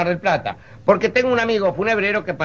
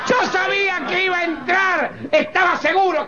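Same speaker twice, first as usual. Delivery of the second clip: triumphant shout; 11 semitones higher than usual. Acted. Host admits thirst, guest shouts in triumph. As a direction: crow it like you won the bet.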